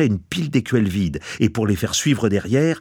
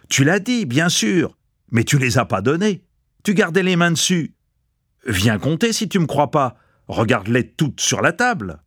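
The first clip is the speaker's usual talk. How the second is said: mild yelling; 6 semitones higher than usual. A narrator's reading. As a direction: argue back in a high, narrated yell. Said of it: "Tchoukov gueule".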